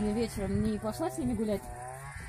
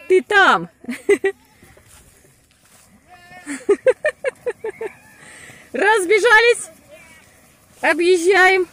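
high, loud encouraging shouts; excited cheering; 9 semitones higher, 11 dB louder than usual. Real, not acted. Cheering kids hauling hay.

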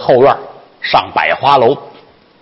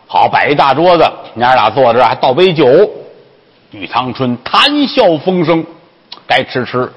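usual speaking voice

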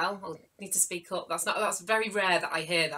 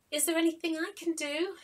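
put-on timid child voice; 9 semitones higher, faster than usual. Child asks pigeon.

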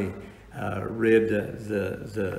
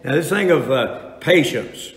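preaching at full force; 4 semitones higher, 5 dB louder than usual. Acted. Preaching on patience.

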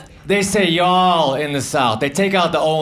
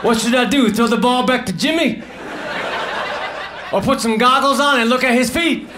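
higher, exasperated actor griping; bored pool actor; 5 semitones higher than usual. Pretend. Actor in a pool ad griping, mildly exasperated. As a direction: whine like a stuck commercial actor. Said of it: "the actors would have said".

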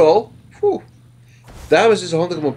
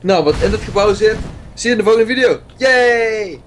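youtuber outro; 7 semitones higher, 4 dB louder than usual. Acted. Video outro to viewers.

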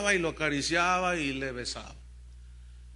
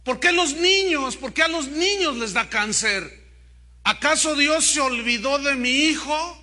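loud, high anguished protest; acted consternation, forceful and pitched up; dismayed; 8 semitones higher, 8 dB louder than usual. A narrator's reading. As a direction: cry out as a distraught mother. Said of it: "Susi dijo consternada".

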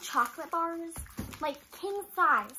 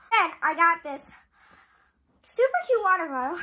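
breathless talking; out of breath; 6 dB louder than usual. Real, not acted. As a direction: speak while catching breath. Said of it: "I'm out of breath".

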